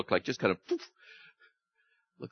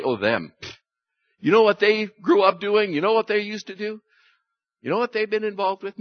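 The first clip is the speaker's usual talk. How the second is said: scornful gossip, higher pitched; dismissive; 8 semitones higher, 5 dB louder than usual. Retold or imitated. A judgmental churchgoer writing someone off, scornful. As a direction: sneer at them as a lost cause. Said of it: "write off and say".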